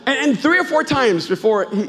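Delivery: usual speaking voice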